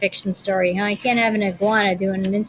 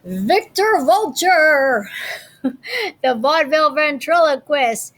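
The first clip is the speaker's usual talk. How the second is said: slow, louder, higher showman announcement; grand announcer; 4 semitones higher, 4 dB louder, slower than usual. Acted. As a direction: announce him like a circus host. Announces character name grandly.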